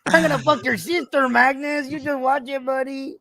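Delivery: put-on high Spanish accent threat. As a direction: keep the Spanish accent, menacing. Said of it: "in a Spanish accent".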